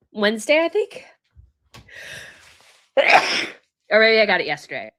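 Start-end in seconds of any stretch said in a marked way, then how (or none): 3.0-3.5 coughing. Real, not acted. Cough after sneeze fit.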